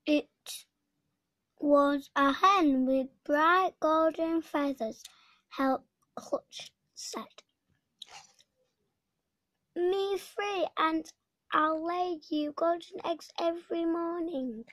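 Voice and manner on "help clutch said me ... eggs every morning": the hen speaking. A narrator's reading. Golden hen begs freedom.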